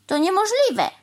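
high, loud rebuttal; flatly refuse, voice jumping up; indignant denial. A narrator's reading. Crow rejecting the cow's crooked work, indignant.

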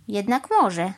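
plain reading voice

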